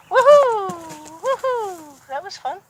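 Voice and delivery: high, loud whoop of joy; excited cheer. Real, not acted. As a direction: cheer like the ride was fun. Cheering the horse's burst.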